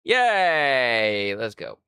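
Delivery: normal talking voice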